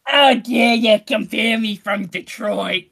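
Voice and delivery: put-on slow, loud, affronted New Jersey accent; mock-indignant Jersey accent. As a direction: ham up an offended Jersey local. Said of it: "Yeah, she's like".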